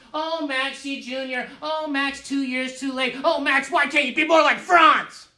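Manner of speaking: put-on sing-song kids' taunt building to a loud shout; schoolyard taunting. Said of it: "all the kids making fun of me".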